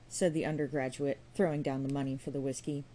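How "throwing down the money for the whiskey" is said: plain reading voice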